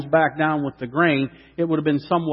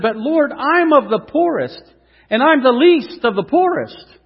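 higher, insistent plea; pleading protest; 8 semitones higher, 5 dB louder than usual. Acted. Gideon objecting to the Lord, higher pleading voice. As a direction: protest you're too small.

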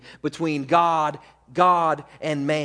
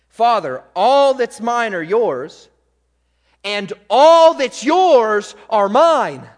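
loud, higher, drawn-out stress on "all"; emphatic scripture reading; 5 semitones higher, 10 dB louder, slower than usual. Acted. Preacher highlights Jesus' claim to the Father, emphatic scripture reading. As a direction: hit "all" hard and stretch it out. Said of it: "I'm highlighting it and I'm emphasizing it".

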